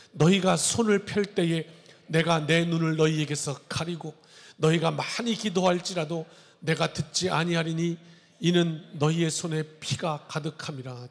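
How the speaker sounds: formal, measured Bible reading voice; solemn scripture reading. Acted. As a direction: read it out like holy writ from the pulpit. Reads scripture aloud.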